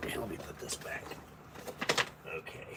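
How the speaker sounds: soft whispered aside. Real, not acted. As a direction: whisper it to yourself. Aside while handling the food.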